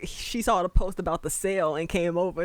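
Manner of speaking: ordinary delivery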